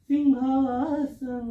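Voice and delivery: slow, drawn-out romantic croon; sung-out, lingering word in a love mood; loving. Shown as a demonstration. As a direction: let the word melt out lovingly. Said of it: "प्रेम में कहीं".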